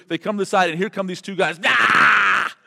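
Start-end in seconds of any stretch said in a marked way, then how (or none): 1.6-2.5 sudden loud high scream; loud acted shriek; mock demon scream. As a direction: scream like a wild man charging. Demoniacs charging at disciples.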